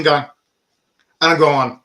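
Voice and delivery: ordinary talk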